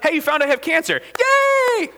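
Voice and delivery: high, sarcastic fake celebration; mock cheer. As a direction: cheer absurdly at bad news. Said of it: "Said no one ever".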